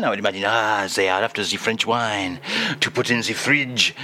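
hammed-up fake French accent throughout; pompous Frenchman lecturing on wine in a put-on French accent; mock French accent. Comedy French character voice.

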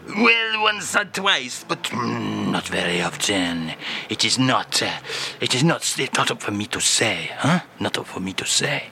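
put-on a lightly French-accented old singer; hint of a French accent, coy.

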